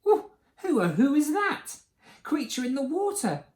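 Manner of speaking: high hooting wonder; wide-open curious little dinosaur voice; puzzled asking. A narrator's reading. Drip sees his reflection, high puzzled hoot. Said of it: "he asked them".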